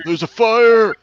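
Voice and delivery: high, loud fire alarm yell; alarmed grunt shout. Acted. Game master voices grunts spotting fire, alarmed shout. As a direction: shout a warning to the whole camp. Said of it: "uh, grunts".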